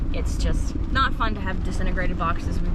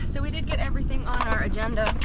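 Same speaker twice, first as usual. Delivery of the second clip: ordinary talk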